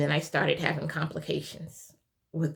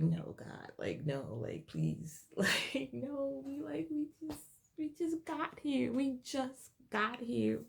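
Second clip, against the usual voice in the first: desperate quiet plea; 7 semitones higher, 8 dB quieter than usual. Retold or imitated. Pleading after bleeding starts.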